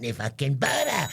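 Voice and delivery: slow, smug and unbothered; smug customer. Pretend. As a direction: drag it out, totally cool.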